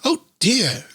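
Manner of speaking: a mild put-on bunny dismay; rabbit voice. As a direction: reader voicing a little rabbit's cry. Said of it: "not even trying to do the voice anymore".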